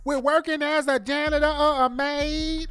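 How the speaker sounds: very high, loud mock Southern sister-girl voice; hammed-up fake Southern twang, squealed high and drawn out; AOC's fake Southern inflection. Host mocking a congresswoman's sister girl act, fake Southern voice. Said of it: "do the fake Southern voice inflection".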